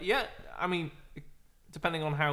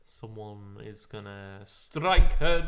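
dramatic announcement; 4 semitones lower, 8 dB louder, slower than usual. Pretend. Dramatic ominous declaration.

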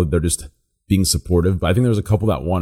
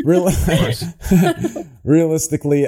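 laughing; 7 semitones higher than usual. Real, not acted. Laughing mid-word after a laugh.

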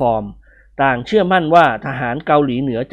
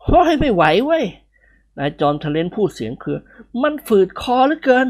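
shaky voice; 7 semitones higher than usual. A narrator's reading.

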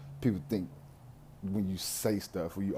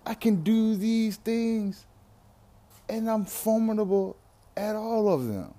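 rising higher and louder into a proud boast, slowing down; confident self; 9 semitones higher, 3 dB louder than usual. Retold or imitated. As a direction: build from a light "yo" to a big, high, triumphant brag. His confident self speaking up.

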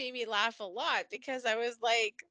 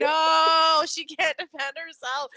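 loud imitation of Carly's protective outburst; mock shout; 3 semitones higher, 9 dB louder, slower than usual. Voicing drugged Carly defending Alexis, loud mock shout. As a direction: belt it out like you're storming in to help.

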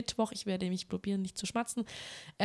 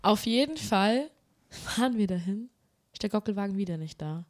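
usual speaking voice

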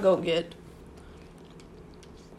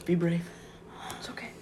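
whisper; 7 dB quieter than usual. Real, not acted. Bracing before spicy bite.

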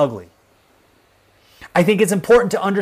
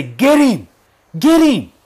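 raised, urgent mob cry; mock mob yell, pitched up; imitated mob shout; 7 semitones higher, 4 dB louder, slower than usual. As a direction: shout it like an angry crowd egging on.

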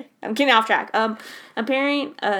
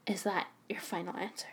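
hushed game-show whisper, much softer; whispered quiz question; 3 semitones lower, 13 dB quieter than usual. Pretend. Quizzing her friend in a teasing, hushed whisper. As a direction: lean in and whisper it like a suspenseful host. Quiz host checking the answer.

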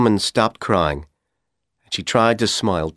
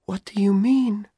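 high breathy whisper, nervous; frightened whispered question; 14 semitones higher than usual. A narrator's reading. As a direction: whisper it, scared of the answer. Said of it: "whispered nervously".

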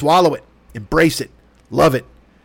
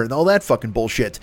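ordinary delivery

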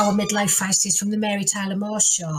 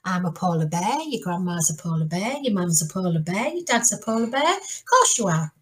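reassuring granddad; 3 semitones lower than usual. Acted. Granddad answering his grandson, reassuring sing-song. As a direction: reassure the youngster, lilting up at each item. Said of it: "And he says".

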